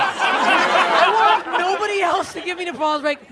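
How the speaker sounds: loud slow pleading; emphatic plea. Real, not acted. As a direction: plead loudly and slowly for applause. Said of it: "that's all I want".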